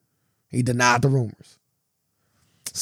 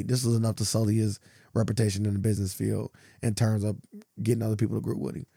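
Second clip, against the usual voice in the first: normal talking voice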